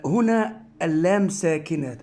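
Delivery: usual speaking voice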